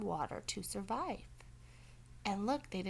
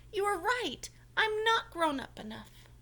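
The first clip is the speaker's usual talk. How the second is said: high, louder remorseful character voice; Franklin's voice; 10 semitones higher, 6 dB louder than usual. Acted. Franklin confessing to Mister Mole, high and remorseful. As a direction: admit it earnestly.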